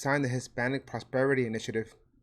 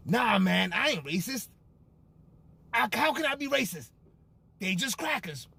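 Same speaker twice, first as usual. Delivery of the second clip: acted dialogue; 7 semitones higher than usual. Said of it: "You ever had this conversation?".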